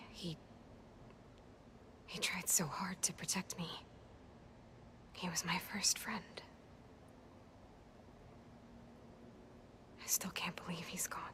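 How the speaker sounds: hushed grief. Acted. Aloy grieving at friend's grave.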